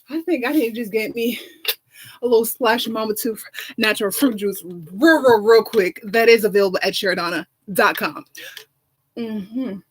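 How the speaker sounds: a diva's hammed-up sponsor plug; campy talk-show ad read; sassy ad read. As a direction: sell the juice like a glamorous host. Sponsor plug for fruit juice.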